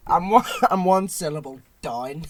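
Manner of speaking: "down" in a Northern Irish accent, like "dine"; Northern Irish vowel on "down". Shown as a demonstration. Co-host laughs at own mistake, Northern Irish accent.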